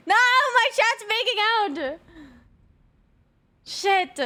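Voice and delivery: high, loud scandalized cry, drawn out slowly; shocked outcry. Real, not acted. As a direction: shriek it like you just walked in on them. Catches chat making out.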